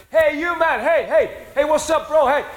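high-pitched mock shout across a distance; calling out. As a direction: hail someone from afar, urgent and friendly. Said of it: "and he shouts".

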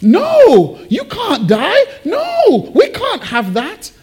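high, stretched, pleading cries; anguished Peter. Acted. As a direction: protest in dismay, wailing the no. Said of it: "It was like".

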